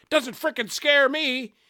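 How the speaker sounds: high, loud yell of bravado; defiant shout. A narrator's reading. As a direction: shout back at the gunman. Bystander shouting back at gunman.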